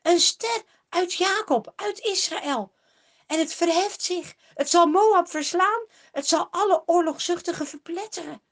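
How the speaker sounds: high, loud exclamation; a seer suddenly proclaiming; calling out. A narrator's reading. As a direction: cry out the vision. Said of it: "Ineens roept hij het uit".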